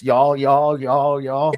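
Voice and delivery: "y'all" drawn out in a thick put-on Southern accent. Host mimicking how Judy talks, in a hammed-up Southern accent. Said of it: "you would leave like this".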